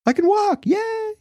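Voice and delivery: very high-pitched squealed panic turning to joy; hammed-up falsetto yelp of a startled man; mock yelling. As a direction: squeal in mock alarm, then cheer. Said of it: "the guy's probably yelling".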